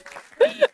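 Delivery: high burst of real laughter; laughing. Real, not acted. Preacher laughing at her own story, high and free. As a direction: laugh out at the irony.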